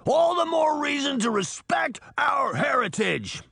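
very high furious shout; snarl. Acted. Titan snarling back at Donovan about heritage. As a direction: spit it out in outrage. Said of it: "Titan snarled".

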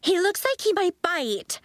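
worried schoolgirl crying out; frightened girl. A narrator's reading. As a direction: warn the class it might bite. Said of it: "a girl cried".

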